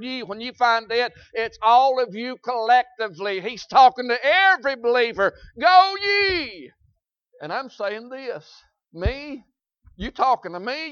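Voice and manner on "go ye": high, loud ringing call; proclaiming command. Acted. Preacher proclaiming to the congregation, loud and high. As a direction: boom the command out to the church.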